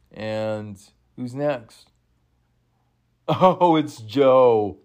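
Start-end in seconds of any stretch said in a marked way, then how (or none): 3.3-4.8 loud delighted cry of recognition; thrilled fan yelling at the screen; excited shout. Real, not acted. Sees favorite wrestler enter.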